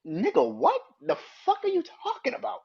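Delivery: high, loud, incredulous yell; exasperated outburst. Real, not acted. Vlogger yelling at [name], high and incredulous. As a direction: explode in disbelief.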